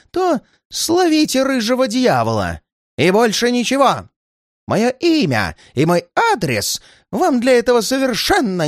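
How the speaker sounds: high-pitched agitated demand; indignant high-pitched old man; old man's outcry. A narrator's reading. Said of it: "Старик так и закричал".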